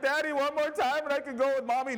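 put-on high, child's bargaining voice; pleading little boy. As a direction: bargain like a tired toddler. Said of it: "he's like".

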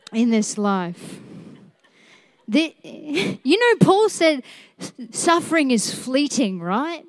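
ordinary talk, nothing marked

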